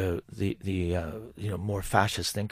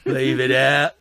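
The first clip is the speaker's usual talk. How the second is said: lightly Cockney, half laughing; Cockney phrase tossed off; Cockney accent; 2 semitones higher, 8 dB louder, much slower than usual. Pretend. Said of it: "Do your Cockney accent again".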